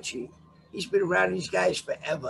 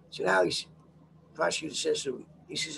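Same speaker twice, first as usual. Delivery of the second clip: ordinary talk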